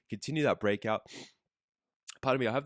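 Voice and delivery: hoarse from the flu. Real, not acted. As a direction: voice fading, sick with flu. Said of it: "I have the flu ... starting to lose my voice".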